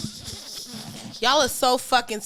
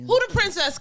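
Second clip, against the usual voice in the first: high sassy rapper quote; [name] voice; 9 semitones higher than usual. Retold or imitated. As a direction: squeak it out with sass. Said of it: "Cash doll voice".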